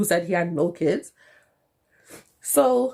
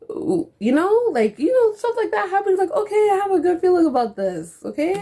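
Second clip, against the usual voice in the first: much higher, bubbly and hopeful; giddy hope; 13 semitones higher than usual. Retold or imitated. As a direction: gush like a girl with a new crush. Recalls hopeful early feelings.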